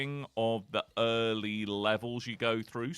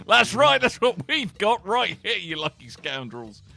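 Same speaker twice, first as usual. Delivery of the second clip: hammy excitement; 8 semitones higher, 10 dB louder than usual. Acted. Hammed show host boast.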